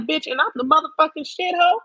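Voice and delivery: sassy self-hype. Retold or imitated. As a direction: hype yourself up like a star. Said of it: "And I'm like".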